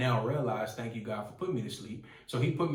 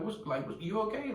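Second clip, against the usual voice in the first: high panicked imitation of himself; retold freaking out; 10 semitones higher than usual. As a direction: replay the confused panic, pitch jumping up. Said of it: "I was freaking out".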